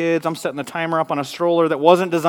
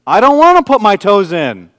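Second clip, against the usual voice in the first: put-on stubborn toddler refusal; 5 semitones higher, 11 dB louder than usual. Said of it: "She says".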